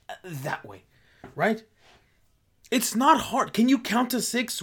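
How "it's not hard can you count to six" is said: higher, louder mocking rant; exasperated ranting. Real, not acted. Ranting at people who ignore six feet, higher exasperation. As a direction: scold them like they can't count.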